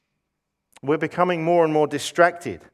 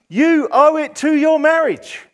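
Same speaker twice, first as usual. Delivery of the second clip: higher, brighter infomercial sign-off; home shopping host; 9 semitones higher than usual. Pretend. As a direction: pitch it like a TV salesman. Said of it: "Shopping channels on T V".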